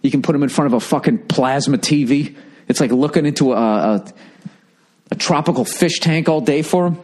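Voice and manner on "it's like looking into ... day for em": usual speaking voice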